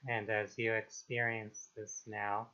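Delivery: calm, unhurried meditation voice with long pauses; guided meditation. Acted. Meditation guide helping listeners release trauma and anxiety, calm and unhurried. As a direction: ease them gently into stillness, phrase by phrase.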